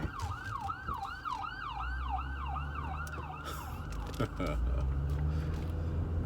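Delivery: a long, very high giggle after a fright; high startled laugh. Real, not acted. Laughing at herself after a fright, squealing high giggle. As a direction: let a squeaky laugh bubble out in relief.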